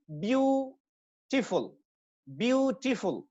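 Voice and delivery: Beautiful with a long, held "BYOO"; teacher's demo of beautiful, first syllable stressed; stressed vowel. Shown as a demonstration. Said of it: "सिर्फ vowels को आपने stress करना है".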